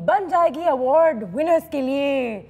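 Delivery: slow, loud filmy hero dialogue; dramatic punchy hero lines. Acted. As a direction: deliver it like a Bollywood hero. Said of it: "ये क्या फिल्मी dialogue है?". (फ़िल्मी hero वाला dialogue अंदाज़)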